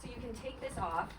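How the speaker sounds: usual speaking voice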